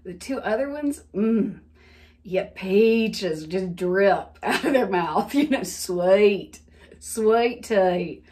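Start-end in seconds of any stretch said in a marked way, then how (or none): 2.2-8.2 slow, dripping Southern twang; Southern accent. Retold or imitated. Recalling two grandmothers' speech, slow Southern twang. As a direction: linger on Southern vowels in "sweet". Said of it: "not a lot of twang ever in her voice".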